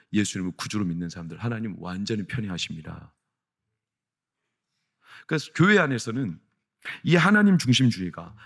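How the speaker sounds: ordinary talk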